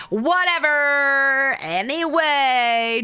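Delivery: slow, drawn-out brush-off; dismissive drawl. Real, not acted. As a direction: wave it away theatrically.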